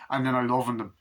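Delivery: ordinary talk, nothing marked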